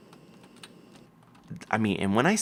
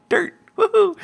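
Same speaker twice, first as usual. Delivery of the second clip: excited whoop; 6 dB louder than usual. Real, not acted. Celebrating finding dirt.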